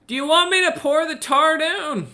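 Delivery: high, calling-down Aussie voice; Australian accent. Acted. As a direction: shout a question down from above in an Australian accent. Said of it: "the Aussie above".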